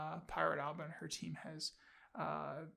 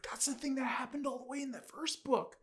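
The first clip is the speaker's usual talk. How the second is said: surprised realisation; 8 semitones higher than usual. Retold or imitated. Realising an earlier hint.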